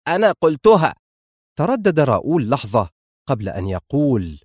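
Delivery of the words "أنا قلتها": high, loud angry retort; raised, forceful assertion of authority; shouted. A narrator's reading. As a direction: shout it back at him. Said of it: "صرخت فيه".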